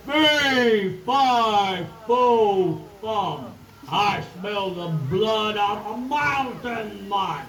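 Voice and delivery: loud, slow giant's boom; big booming voice. Acted. Giant booming at the children, loud and slow. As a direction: boom out each word like a giant. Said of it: "in a big, loud, booming voice".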